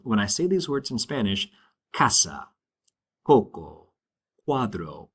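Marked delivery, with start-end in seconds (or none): 1.9-5.1 casa, coco, cuadro with a hard K sound. Shown as a demonstration. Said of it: "it's a very hard sound".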